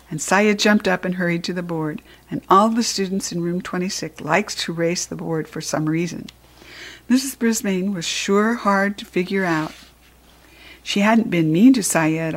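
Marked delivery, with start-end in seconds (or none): none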